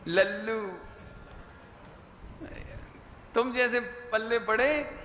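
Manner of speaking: slow, drawn-out taunting; mock wifely reproach. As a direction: imitate a wife reproaching her husband, slowly. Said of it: "पत्नी हमेशा उलाहना देगी". (पत्नी के उलाहने की नकल)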